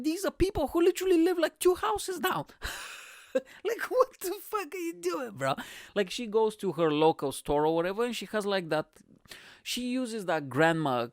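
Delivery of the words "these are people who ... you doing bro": high, mock-indignant girlfriend voice; girlfriend's outraged voice. Retelling his girlfriend's complaint about the neighbors, high and indignant. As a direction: squeak it out like she can't believe it. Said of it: "she was like".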